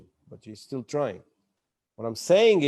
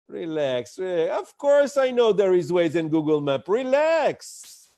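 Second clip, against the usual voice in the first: louder, higher, playful reassurance; reassuring relax; 3 semitones higher, 5 dB louder than usual. Shown as a demonstration. Presenter teasing the doubtful audience, loud and high. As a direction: tease the room, then lift the last relax high. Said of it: "you take a breath and you go".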